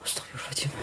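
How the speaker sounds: breathless frightened whisper; whispered prayer. Real, not acted. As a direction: whisper it in fear. (bisikan ketakutan)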